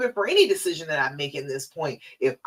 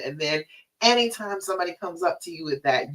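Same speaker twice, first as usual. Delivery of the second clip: usual speaking voice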